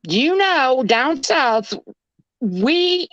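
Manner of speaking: high, sweetly scolding Southern impression; cute Southern lady. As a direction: imitate a Southern belle warning off a burglar. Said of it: "this s-, cute Southern lady comes on".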